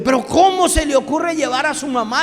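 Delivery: indignant disbelief. Retold or imitated. Groom protests bringing mother-in-law.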